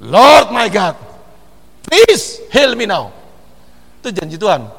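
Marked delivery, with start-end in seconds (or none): 0.0-3.1 loud, slow pleading prayer; crying out to God. Shown as a demonstration. Preacher demonstrating how to shout a prayer to God. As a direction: plead slowly and loudly for healing. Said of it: "teriakan sama Tuhan dong".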